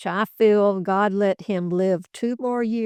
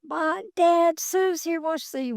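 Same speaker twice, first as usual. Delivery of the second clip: imitated high-pitched call to someone upstairs; 9 semitones higher than usual. Daughter calls her dad downstairs for a visitor, imitated high-pitched calling. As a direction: call out for Dad in a raised pitch, without much extra volume. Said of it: "and she calls him, you know, upstairs".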